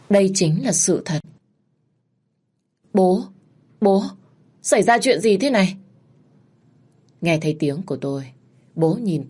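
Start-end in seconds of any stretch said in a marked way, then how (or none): 2.9-5.8 high, alarmed calling of father; startled and pleading; louder shocked plea to dad; shocked cry. Acted. Daughter finds father after killing.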